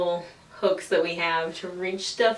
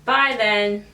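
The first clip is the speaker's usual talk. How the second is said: sarcastic send-off; 6 semitones higher, 4 dB louder, slower than usual. Retold or imitated. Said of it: "I'm like".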